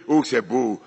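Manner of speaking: ordinary talk, nothing marked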